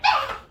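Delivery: high, loud mock shriek of "Ah!"; retold scream. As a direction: yelp it out like a startled girl in a horror game. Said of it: "And then she's like".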